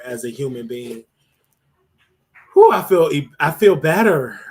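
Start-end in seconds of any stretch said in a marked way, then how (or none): 2.5-4.4 relieved. Real, not acted. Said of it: "I feel better 'cause I was able to get that out".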